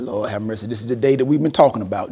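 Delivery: low, hushed aside to God; muttered prayer. Retold or imitated. Principal praying as two boys approach him, muttered low. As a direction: pray it under your breath.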